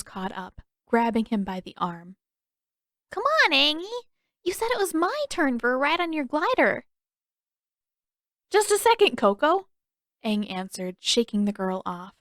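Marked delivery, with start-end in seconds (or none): 3.1-6.9 put-on high, sing-song pleading girl; girly fan voice. Fan girl tugging at Aang, coaxing whine. 8.5-9.7 put-on boyish brush-off. Aang brushing off fan.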